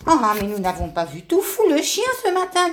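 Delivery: a touch of wonder; noticing something is missing; surprised. Acted. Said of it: "dit Grande Feuille étonnée".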